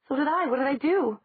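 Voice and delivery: high, loud startled cry. Acted. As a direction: caught off guard, alarmed. Elena startled, floating up.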